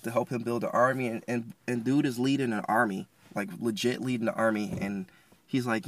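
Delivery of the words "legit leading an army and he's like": ordinary delivery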